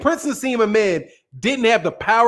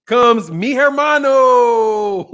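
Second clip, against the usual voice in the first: slow, stretched, higher announcement breaking into a laugh; drawn-out punchline; 4 semitones higher, 4 dB louder, much slower than usual. Pretend. Fight fan hailing the Mexican boxer's arrival, drawn-out and laughing. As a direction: draw it right out, then laugh.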